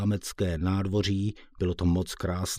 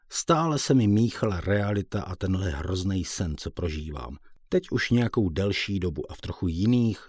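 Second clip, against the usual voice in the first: usual speaking voice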